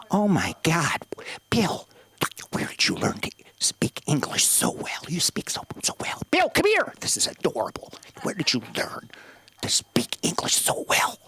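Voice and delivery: breathy hushed gushing over the wife's English; half-whispered condescending amazement; hushed fawning old lady. Retold or imitated. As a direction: whisper it like a patronizing lady marveling. Imitating older Caucasian couple.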